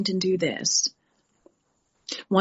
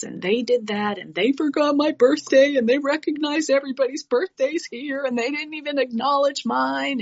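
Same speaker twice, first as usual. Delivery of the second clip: higher whiny rant, rushing on; whiny complaining; 6 semitones higher than usual. Retold or imitated. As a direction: whine like a hurt kid. Mimicking her own old complaining.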